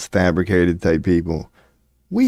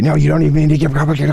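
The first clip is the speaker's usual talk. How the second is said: much higher, rapid excited agreement; eager young Shapiro; 7 semitones higher, 3 dB louder, much faster than usual. Retold or imitated. Young Shapiro eagerly agrees with recruiters, fast high voice.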